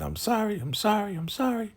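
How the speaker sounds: high, pleading repeated sorry; a mock upset girl begging forgiveness; emotional apology. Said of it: "Zane is all emotional".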